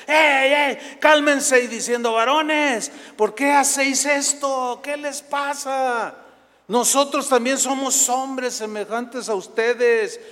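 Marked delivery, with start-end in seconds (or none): 0.1-1.6 high, loud shout over a crowd. Acted. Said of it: "gritando, dando voces". 2.1-10.2 high, slow, drawn-out calls to a crowd; shouting out. Acted. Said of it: "gritando, dando voces".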